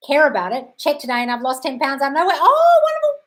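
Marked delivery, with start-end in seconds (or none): 2.4-3.2 very high, loud delighted gush; thrilled. Real, not acted. Coach hearing a viewer lost ten pounds, thrilled. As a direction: celebrate it with a soaring cry.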